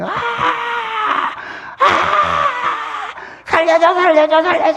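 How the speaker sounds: high desperate shout of the damned; a piercing high cry for rescue; screaming for help. Retold or imitated. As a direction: scream for your life from the flames. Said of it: "사람들이 소리 지르는데".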